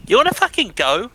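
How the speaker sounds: mock-furious London taunt; mock angry challenge. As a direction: pick a pretend pub fight.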